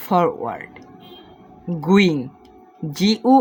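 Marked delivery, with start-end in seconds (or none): 0.1-0.7 forward said much lower, softer; forward as a low settled ending; low final word. Shown as a demonstration. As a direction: drop forward low and soft to close. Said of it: "এরকম ভেঙে ভেঙে উচ্চারণ করবেন".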